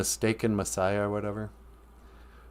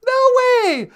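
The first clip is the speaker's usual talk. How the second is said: very high, loud delighted squeal; thrilled disbelief; 7 dB louder than usual. Real, not acted. Co-host hearing a regular viewer has arrived, thrilled. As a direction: exclaim it in real happy surprise. Reacting to news of Joan joining.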